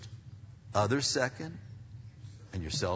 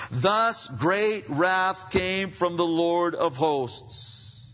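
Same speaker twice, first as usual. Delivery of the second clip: loud, raised preaching voice; full-force scripture reading; 9 semitones higher, 7 dB louder than usual. Real, not acted. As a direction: declaim the verses with force, drawing out the ending.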